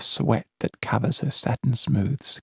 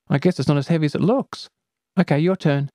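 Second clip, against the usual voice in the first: put-on girl voice; 6 semitones higher, 3 dB louder, much faster than usual. Friend voiced in story.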